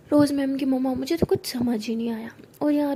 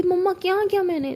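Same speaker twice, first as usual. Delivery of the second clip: higher, louder indignant question; defensive protest; 7 semitones higher, 7 dB louder than usual. Acted. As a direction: push back at mom. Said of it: "तो कहती".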